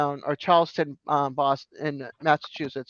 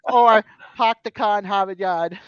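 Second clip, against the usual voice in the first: "park the car in Harvard Yard" as "pahk the cah in Hahvahd Yahd", louder; Boston accent; 5 dB louder than usual. Shown as a demonstration. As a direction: drop every R, Boston style. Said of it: "You drop your R's is what you do".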